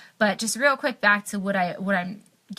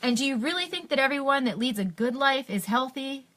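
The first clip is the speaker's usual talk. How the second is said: disbelieving question, pitched up; incredulous; 4 semitones higher than usual. Real, not acted. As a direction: ask it as if it cannot be believed. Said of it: "I couldn't believe when he said that".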